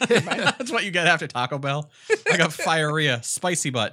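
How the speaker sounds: high, loud laughing joke. Real, not acted. Joking while laughing.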